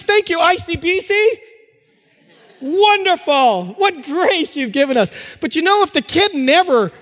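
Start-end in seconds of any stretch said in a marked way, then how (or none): 0.0-5.1 higher, hammed-up gratitude with a laugh; mock thanks. Pastor mockingly thanking the licence office, laughing. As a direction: thank them with playful irony.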